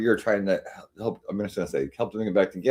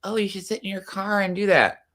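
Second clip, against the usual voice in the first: higher, put-on mock voice of the girl; mocking quote; 10 semitones higher than usual. Streamer imitating the girl taunting her boyfriend. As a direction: toss it off in a cruel mock lilt. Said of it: "because she's like".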